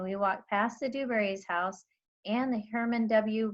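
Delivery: plain reading voice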